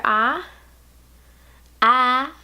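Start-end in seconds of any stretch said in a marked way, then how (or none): 1.8-2.3 loud Southern drawl on "Eye"; "Eye" with a strong Southern vowel; Southern accent. Shown as a demonstration. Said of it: "Southern accent coming out strong".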